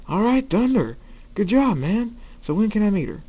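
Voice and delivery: high, loud, hyped-up cheering; excited congratulation. Acted. Firefly learns of his friend's girlfriend, loud excited congratulation.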